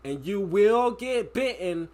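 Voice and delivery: a cop at the door warning an intruder, mock-stern and slow; unserious cop. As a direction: play a cop bluffing about a dog, slow and loud. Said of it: "it's just the way he was saying it".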